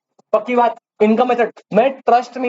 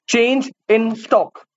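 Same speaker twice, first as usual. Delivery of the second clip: drawn-out dictation; much slower than usual. Shown as a demonstration. Teacher dictating a key term to the class, very slow and drawn out. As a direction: stretch each word very slowly so students can write. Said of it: "क्या add करना पड़ता है बेटा?".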